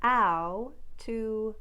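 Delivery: ow said with open ah start; ow as ah-uh glide; standard ow. Shown as a demonstration. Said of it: "Newfoundland sound number two".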